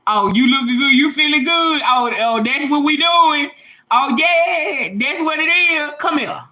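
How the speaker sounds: knowing, teasing call; a hint of God beckoning you over; lightly voiced teasing God. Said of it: "God have a way of saying".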